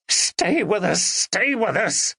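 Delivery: high, slow desperate plea; pleading. A narrator's reading. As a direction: Ben begs the unconscious Andrea. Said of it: "Ben pleaded".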